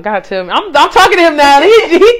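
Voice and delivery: normal talking voice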